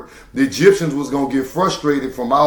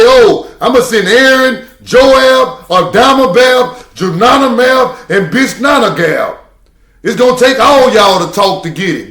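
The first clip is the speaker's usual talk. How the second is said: much higher and louder, listing silly names; mock God voice; 6 semitones higher, 6 dB louder than usual. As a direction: ham it up as God rambling off names. Mocking God sending many speakers.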